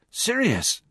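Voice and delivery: high-pitched surprised exclamation; startled. A narrator's reading. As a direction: gasp the name out in astonishment. Ron suddenly sees Sirius in fire.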